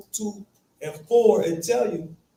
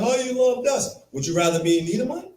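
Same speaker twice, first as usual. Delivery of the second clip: higher-pitched mock wheedling question; playfully pitched up; mock appeal; 8 semitones higher, faster than usual. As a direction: tease with a put-on coaxing question. Mock appeal voiced for Israel.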